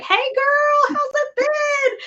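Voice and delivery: high, loud, drawn-out mock demon greeting; hoge uitgelaten demonenstem. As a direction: squeal it like a demon greeting an old pal. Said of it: "to be like".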